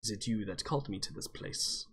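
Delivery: put-on low, quiet gruff old dwarf; a puzzled old dwarf, softly. GM voicing a confused old dwarf to the cleric. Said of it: "and he says".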